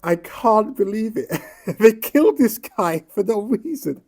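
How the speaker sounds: real outrage with incredulous laughter; outraged disbelief. Real, not acted. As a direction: laugh in disbelief while shouting it out. Outraged rant about a killing.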